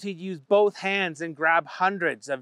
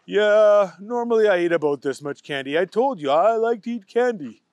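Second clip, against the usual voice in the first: matter-of-fact Booger; 3 semitones higher, 5 dB louder than usual. Acted. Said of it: "Booger said".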